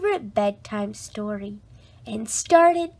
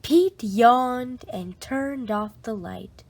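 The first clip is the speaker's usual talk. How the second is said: ordinary delivery, nothing marked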